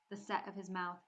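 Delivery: usual speaking voice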